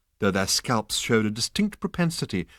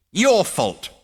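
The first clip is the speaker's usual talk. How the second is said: high loud screech; 10 semitones higher, 7 dB louder than usual. A narrator's reading. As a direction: screech the blame. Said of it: "he screeched".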